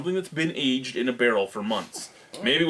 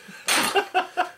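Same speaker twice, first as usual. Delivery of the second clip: high laugh. Real, not acted. Laughing at own joke.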